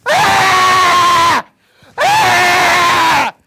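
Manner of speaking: long, loud, high screams; screaming. Acted. Wife screaming at no one in particular, wordless screams. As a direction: scream wordlessly at full volume. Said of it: "just screaming like this".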